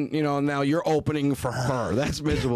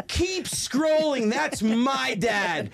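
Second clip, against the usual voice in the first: put-on kid bragging; 10 semitones higher, much slower than usual. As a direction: imitate a child showing off, word by word. Daughter brags to schoolmate.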